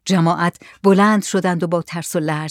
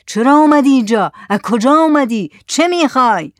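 raised, louder volley of questions; loud bellow; 6 semitones higher, 5 dB louder than usual. Acted. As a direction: bellow each question at the stranger. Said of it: "با صدای بلند نعره کشید".

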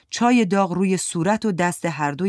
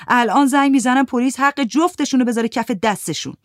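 louder, higher threatening yell; shouting threat; 7 semitones higher, 6 dB louder than usual. Acted. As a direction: threaten to call the police, shouting. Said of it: "داد زد". (تهدید فریادزنان با صدای بلندتر)

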